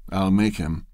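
plain reading voice

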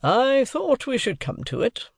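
high, slow triumph; triumphant. A narrator's reading. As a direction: relish having seen it coming. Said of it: "Mister Jaggers said triumphantly".

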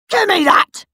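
screamed. Acted. Henry lunging for Peter's diary, screaming. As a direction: yell it as you lunge. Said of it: "screamed Horrid Henry".

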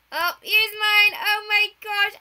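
loud, slow horrified gasp; shocked at own build; dismayed shriek. Real, not acted.

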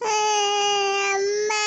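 long wailed call for mother; child crying. Acted. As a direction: bawl it out like a thwarted child. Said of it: "இப்ப எதுக்கு அழுவுற?".

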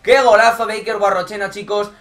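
loud high goal cry; goal commentary shout. Acted. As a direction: keep yelling the goal. (grito de gol)

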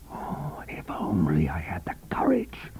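soft, breathless wish; despairing whisper. A narrator's reading. Husband alone by his wife, whispering in despair. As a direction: whisper it in anguish.

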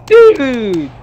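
loud, high, drawn-out protest; exasperated shout. Real, not acted. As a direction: call out in loud gamer frustration. Protesting during frustrating game.

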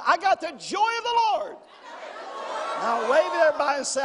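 loud, high shouted call and fast urging; preaching shout. Acted. Pastor rallying the congregation a second time, loud and high. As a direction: lift the whole room.

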